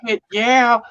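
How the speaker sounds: high, loud whoop of approval; excited agreement. Retold or imitated. Retelling an eager reply to his father-in-law, excited.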